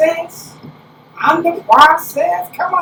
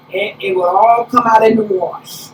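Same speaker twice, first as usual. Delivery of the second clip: ordinary talk, nothing marked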